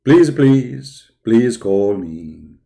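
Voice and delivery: slow, hammed-up pleading on the last please; mock begging. Streamer mock-pleading with the Dawah team, slow begging. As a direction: beg theatrically, not meaning it.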